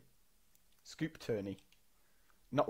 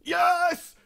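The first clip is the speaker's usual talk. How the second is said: a sudden, much higher and louder yes; excited yell; 10 dB louder than usual. Real, not acted. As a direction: yell it out in real triumph. Scoring a goal, celebrating.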